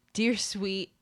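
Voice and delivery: usual speaking voice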